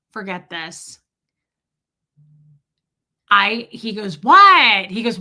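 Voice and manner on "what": high, very loud imitation of dad; shouted, impatient phone answer; dad yelling. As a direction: bellow it over a noisy bar. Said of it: "it's, like, loud".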